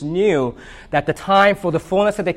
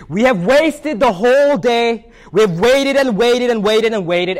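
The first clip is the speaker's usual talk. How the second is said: higher, louder, slow exasperated cry; exasperated yell; 8 semitones higher, 4 dB louder than usual. Acted. Elephant at sundown yelling at Piggy in exasperation. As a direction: throw up your hands and wail at the wasted day. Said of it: "yells in exasperation".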